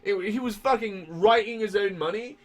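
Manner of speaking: high, loud, shouting disbelief; incredulous rant. Real, not acted. Ranting to cohost about Joseph Smith, loud incredulous shouting. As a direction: rant at the top of the voice, stretched out.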